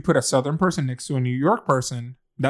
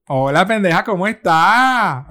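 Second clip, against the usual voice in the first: Puerto Rican accent; 5 semitones higher, 6 dB louder than usual. Shown as a demonstration. Greeting Puerto Rican listeners in a loud Puerto Rican accent. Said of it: "I had a Puerto Rican accent".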